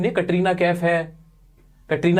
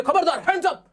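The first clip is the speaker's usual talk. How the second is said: high mock gunman order; hammed-up hands-up threat; a spy holding a gun on the hero; 8 semitones higher than usual. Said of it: "उसने ऐसे तान रखी है".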